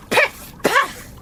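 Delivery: high, popping gun sounds; mock gunshots. Narrator imitates the hunters' guns going off, sharp and high. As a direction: spit them out like sudden shots.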